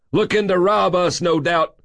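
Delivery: high, huffy little man; indignant, high-pitched accusation. A narrator's reading. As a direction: huff it out, pitched well up. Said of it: "the man huffed".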